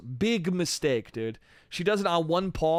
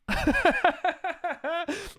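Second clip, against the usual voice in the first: high laugh. Real, not acted. Laugh after the bit.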